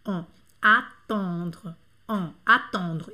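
slow on then attendre with a rounded nasal. Shown as a demonstration. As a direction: stretch the on of attendre slowly. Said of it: "não tem nada a ver com attendre". (on de attendre lento e arredondado)

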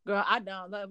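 ordinary talk, nothing marked

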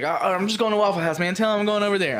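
high, loud insistent announcement; imitated agitation. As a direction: announce it like a rattled man leaving. Acting out victim announcing his exit.

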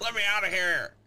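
high, loud panicked plea; high panicked girlfriend voice; trapped girlfriend. Acted. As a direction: beg high to be let out.

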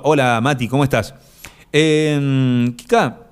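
normal talking voice

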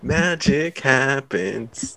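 slow, grand ad slogan; announcer slogan voice. Acted. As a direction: draw out the tagline like an ad.

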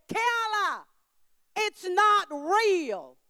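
grandma shouting over the ride to calm her granddaughter, loud and slow; urgent shout. Retold or imitated. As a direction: shout "it's not real" to break through her fear. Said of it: "And I said".

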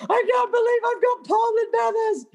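high, overwhelmed cry of joy, near tears; half-crying it out in disbelief; emotional cry of joy. Retold or imitated. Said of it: "And I'm screaming".

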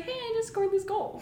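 high, soft cutesy voice with a laugh; cute little girl. Retold or imitated. Retelling a young player's goal to friends, cute little-girl voice. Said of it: "like, this cute little girl".

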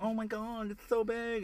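high, breathy mock amazement; fake excited gasp. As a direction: overdo the fake delight. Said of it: "the pretend".

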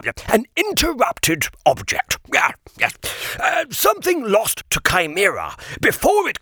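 a high, dithering, hesitant gentleman; flustered old guardian. Acted. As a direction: stammer and fumble for the words. Sir Regulus explains to Kip.

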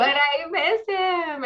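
high, slow, pouty whimper; mock whiny sadness. Mother missing bedtime cuddles, high slow pouty whine. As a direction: pout it like a sulking child.

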